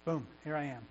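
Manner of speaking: soft, hushed prayer of surrender; humble surrender. Shown as a demonstration. Believer simply comes to God to be fed, hushed. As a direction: quietly offer yourself up. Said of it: "just start out with honesty".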